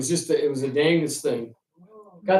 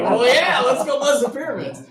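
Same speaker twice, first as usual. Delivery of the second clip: much higher, laughing and eager; gleeful agreement; 10 semitones higher, 4 dB louder than usual. Retold or imitated. Pilot agrees to buzz the pyramids, gleeful. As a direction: give in with a delighted grin.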